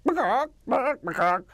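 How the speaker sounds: high squawking clucks; chicken clucks. Shown as a demonstration. Actor demoing the rooster walking, high chicken clucks. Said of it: "he's gonna sound like chickens".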